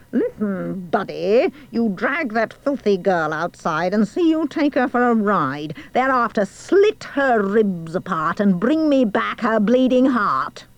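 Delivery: menacing queen, mild shout; queen shouting orders. A narrator's reading. Queen commanding the huntsman to kill, menacing mild shout. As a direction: order him about with mounting menace. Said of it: "She shouted at him".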